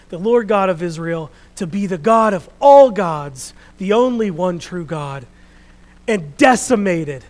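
big, emphatic pulpit storytelling; forceful preaching. Real, not acted. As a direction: proclaim it at full force to the congregation.

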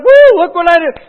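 high, loud triumphant whoop; excited whoop. Acted. Servant shows master his gain, high loud whoop. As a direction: crow over what you did. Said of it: "He's like".

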